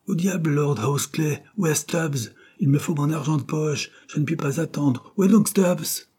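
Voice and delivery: high-pitched exasperated demands; impatient. A narrator's reading. Dare's son demanding Stubbs and pocket money, impatient.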